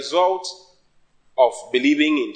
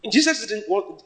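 normal talking voice

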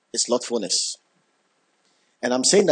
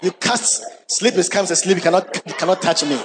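laughing excitedly; 7 semitones higher than usual. Real, not acted. Preacher teasing the congregation, laughing and excited. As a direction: bubble over with glee at the joke. Joking about bingeing Game of Thrones.

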